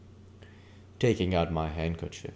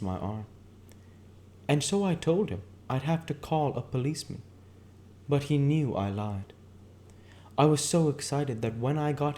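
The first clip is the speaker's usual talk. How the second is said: much higher woman's voice, wistful; Myrtle, dreamy reminiscence; 8 semitones higher than usual. Acted. Myrtle telling Nick how she met Tom, higher dreamy voice. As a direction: pitch way up and drift into the memory.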